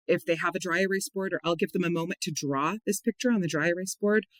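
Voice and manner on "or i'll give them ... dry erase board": ordinary talk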